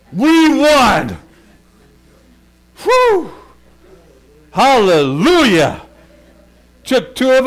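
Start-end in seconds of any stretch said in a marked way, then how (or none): 0.1-1.2 higher and louder we won; triumphant. Real, not acted. Preacher tells the church they won, triumphant. 2.8-3.3 high loud exhale of relief; relieved whew. Real, not acted. After the coon fight, a loud relieved whew. 4.5-5.8 loud, slow hallelujah; joyful praise. Real, not acted. Preacher praises God for the victory, loud and slow.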